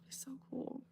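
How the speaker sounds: soft wistful whisper, almost no voice; whispered so cool; breathy whisper. Real, not acted. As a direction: breathe it out in awe. Dreamy whisper imagining reunion.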